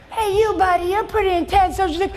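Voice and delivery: put-on high-pitched kid voice. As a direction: call out like the kid in the third row. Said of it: "third row, he raises it, he goes".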